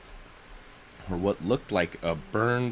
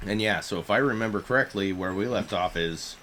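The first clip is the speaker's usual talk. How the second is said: ordinary delivery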